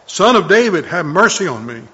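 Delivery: loud pleading cry; crying out again. A narrator's reading. Blind beggar crying out over the crowd. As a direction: call out again, still loud. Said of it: "he kept crying out all the more".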